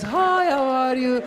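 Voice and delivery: high, drawn-out smiling greeting; friendly Persian demo. Shown as a demonstration. Comic showing how Persians talk, exaggeratedly friendly and stretched. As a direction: sing it out slow, loud and friendly. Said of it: "We talk like this".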